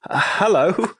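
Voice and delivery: imitating the man's high, breezy hello; casual hello. Mock high greeting from the unsuspecting troll. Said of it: "he's like".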